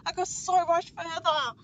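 loud, high exasperated yell; frustrated outburst. Real, not acted. Gamer failing after getting so much further, frustrated outburst. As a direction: cry out in real frustration.

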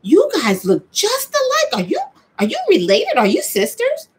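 high, amazed stranger voice; curious stranger. Retold or imitated. Strangers asking the sisters if they are related, high and amazed. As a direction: play the wide-eyed stranger.

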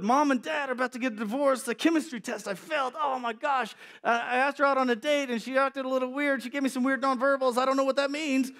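put-on spiral into panic as a teenager; anxious teen panic.

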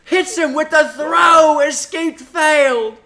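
loud, high hammed-up British commentator; British accent. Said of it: "They'll put in a British accent".

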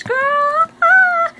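high-pitched loud squeal; squealing over good news; excited squeal. Real, not acted. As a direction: shriek with delight.

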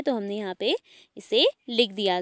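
normal talking voice

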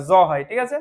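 normal talking voice

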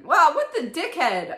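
loud, slow, stunned exclamation; loud shocked reaction to the father; outraged outburst. Real, not acted. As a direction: blurt it out in disbelief. Reader outraged at the story.

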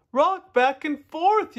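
high, loud, drawn-out yell. A narrator's reading. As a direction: yell the instruction slowly and loudly. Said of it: "yelled the turtles".

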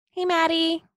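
high call through a door; yelling. Acted. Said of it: "a voice yelled through the bathroom door".

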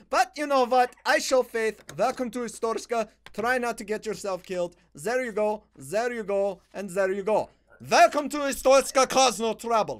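hammy Slavic border-guard welcome; play-acted Slavic inspector; mock Eastern European accent. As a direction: send them through with a put-on Eastern European accent. Inspector admitting traveler in accent.

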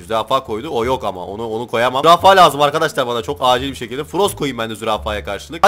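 normal talking voice

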